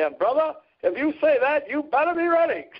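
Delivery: pitched well up, preaching it with fervor; imitating Billy Graham. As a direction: quote the famous evangelist from the pulpit, high and ringing. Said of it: "and he said".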